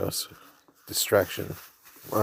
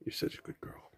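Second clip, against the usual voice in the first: breathy whisper to a pet; 15 dB quieter, faster than usual. Real, not acted. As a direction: whisper it tenderly to the cat. Whisper to cat.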